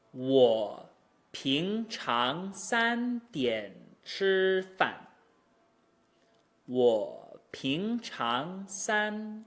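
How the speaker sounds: very slow, exaggerated tones on 平常三点吃饭; teacher's drawn-out model of each syllable; model Mandarin tones. Shown as a demonstration. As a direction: stretch every rise and level tone. Teacher models the example sentence.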